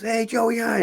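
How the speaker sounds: high-pitched imitated hello; friendly greeting. Actor sheepishly greets him, retold.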